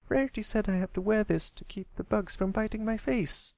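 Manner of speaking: put-on very high young filly voice; defensive little filly. Sweetie Belle defending her hat, in a very high little voice. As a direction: explain the silly hat earnestly.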